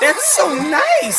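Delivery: high, slow, loud mock gush. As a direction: mimic her voice jumping way up. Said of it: "See how her voice went up?".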